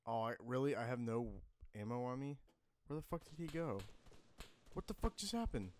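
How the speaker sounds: loud rising panic under fire; panicking gamer. Real, not acted.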